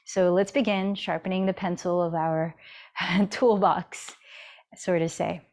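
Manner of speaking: ordinary talk, nothing marked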